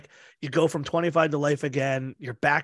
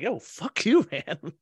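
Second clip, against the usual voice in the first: pitched-up mock snap that dissolves into a laugh; mock angry retort; 3 semitones higher, much slower than usual. Retelling the friend's switch to anger, mock yell into laughter. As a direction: fake fury, then crack up. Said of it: "And it's like".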